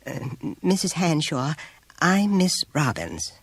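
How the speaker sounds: high, slow, hesitant introduction; diffident. A narrator's reading. Teacher nervously phoning a pupil's mother, diffident. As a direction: introduce yourself timidly, ending on a rising note. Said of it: "She said, diffidently".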